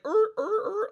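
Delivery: seal barking imitation.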